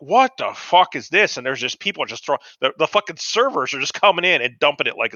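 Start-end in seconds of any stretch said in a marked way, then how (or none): 0.0-1.4 slow, higher-pitched disbelief; retold dismay. New dishwasher staring at piled plates, slow incredulous dismay. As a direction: draw out each word in mock astonishment.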